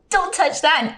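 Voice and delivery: high, grossed-out warning; mock-appalled, high-pitched order; retold scolding. Retold scolding after smacking shoe.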